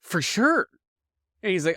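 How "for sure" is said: high, gleeful warning; teasing warning. Retold or imitated. Teenager warning Josh they'll mock him, gleeful. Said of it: "I'm like".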